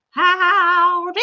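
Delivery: high, loud sung howdy; Granny D's howdy. Retold or imitated. Joining Granny D's live greeting, sung howdy. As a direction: belt it like a cheery cowgirl greeting. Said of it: "doing her howdy with her".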